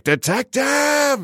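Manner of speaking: long high screaming call of Detective; screamed Detective. Retold or imitated. Imitating the killer screaming Detective at Brad Pitt. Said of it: "He's like".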